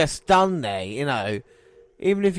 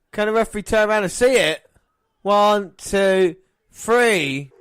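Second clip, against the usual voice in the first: fast, rising commentator call; excited play-by-play; 3 semitones higher than usual. Acted. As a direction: call the action live.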